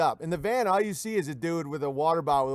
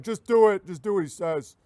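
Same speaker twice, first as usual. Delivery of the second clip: a mocking voice of Trump caving, the first line louder; 3 dB louder than usual. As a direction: bark it like a pushover boss caving in. Trump voiced obeying Bill Gates.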